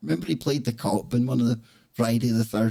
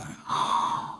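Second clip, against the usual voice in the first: mock gasp; 5 dB quieter than usual. Mock horror after death.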